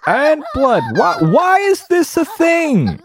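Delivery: squeaky high incredulous cry; shocked disbelief. Real, not acted. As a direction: shriek out in disbelief. Horrified at game scene.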